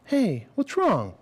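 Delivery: high, slow caring question; concerned girlfriend. Retold or imitated. Girlfriend noticing he's upset, slow and caring. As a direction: ask gently, drawing it out.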